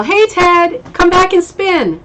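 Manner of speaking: loud high call across the house. Real, not acted. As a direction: call out to him in the next room. Calling to son in other room.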